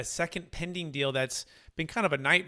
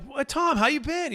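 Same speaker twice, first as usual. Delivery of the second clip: excited greeting; 11 semitones higher, 4 dB louder than usual. Retold or imitated. Cheerful phone greeting to client.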